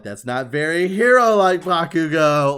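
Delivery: loud, high teasing call; mock teasing. Classmates making fun of cocky hero, loud mock taunt. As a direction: needle him loudly, imitating the crowd. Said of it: "they always fuck with him".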